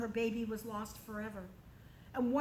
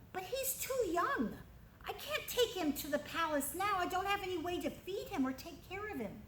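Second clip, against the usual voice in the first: princess; 6 semitones higher than usual. Acted. Princess addresses Jochebed.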